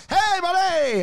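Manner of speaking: high hollered reply across the street; imitated street-side shout; yelled greeting. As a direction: holler it high to someone passing. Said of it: "someone just yells from across the street".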